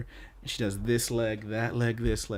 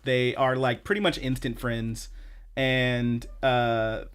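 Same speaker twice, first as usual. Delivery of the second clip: usual speaking voice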